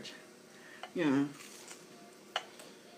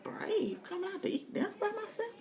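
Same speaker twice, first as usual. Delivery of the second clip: incredulous; 7 dB quieter than usual. Retold or imitated. Retelling her shocked reply.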